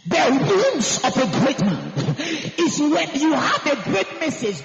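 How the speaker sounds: loud, slow preacher's cry; full-force preaching. Acted.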